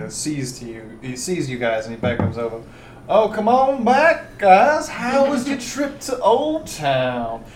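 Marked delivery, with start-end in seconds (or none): 3.1-7.4 drawn-out slurred drawl, pitched high; slurry magistrate. Acted. Opium-hazed magistrate greeting returning adventurers, slurry. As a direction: slur a slow, high welcome. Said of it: "a little more slurry with his words".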